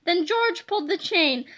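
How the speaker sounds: plain reading voice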